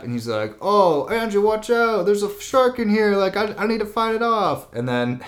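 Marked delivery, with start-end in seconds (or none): 0.6-4.7 high-pitched urgent mock warning; mock alarm. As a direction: sound the alarm, hammed up. Retold faked alarm about shark.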